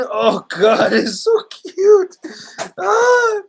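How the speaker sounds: high, drawn-out melting coo; real gushing. Real, not acted. Dad seeing his little one push the door open, gushing.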